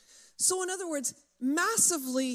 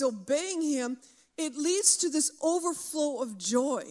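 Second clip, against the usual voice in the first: ordinary talk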